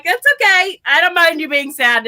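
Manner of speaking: high, singled-out "That's okay", easing down; breezy reassurance. Retold or imitated. To a boss sad to lose her, breezy. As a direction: playfully brush off their sadness. Breezily answers boss's sadness.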